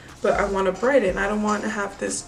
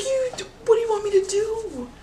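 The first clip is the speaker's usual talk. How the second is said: much higher, put-upon question; exasperated plea; 12 semitones higher than usual. Retold or imitated. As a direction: throw up your hands in words. Exasperated at saleslady.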